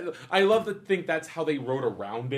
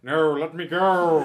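slow laughing plea; whiny pleading; 5 dB louder, slower than usual. Pretend. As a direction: beg to be let go, giggling. Villain caught, laughing.

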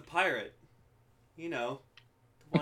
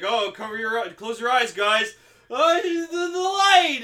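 loud, high call, building; acted warning shout; 8 semitones higher, 10 dB louder than usual. Character warning the guys before the light, acted shout. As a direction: call out a warning, rising to a yell.

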